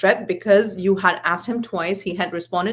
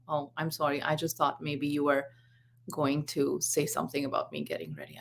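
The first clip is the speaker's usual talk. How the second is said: soft, lower sheepish reply; subdued apology; 5 semitones lower, 8 dB quieter than usual. Retold or imitated. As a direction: imitate a son quietly backing down. Son apologises after calming down.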